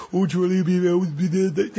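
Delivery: put-on high, babbling baby talk; mock baby voice. Trainer mocking owners who babble at dogs, high baby voice. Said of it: "you'll never see me going".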